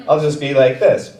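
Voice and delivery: ordinary talk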